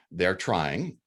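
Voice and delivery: ordinary talk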